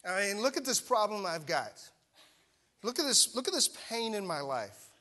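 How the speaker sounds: mock an aggrieved listener; indignant complaint. Imagined listener justifying being upset, indignant. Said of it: "you might even say".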